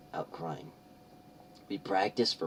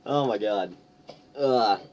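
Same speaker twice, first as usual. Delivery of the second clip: ordinary delivery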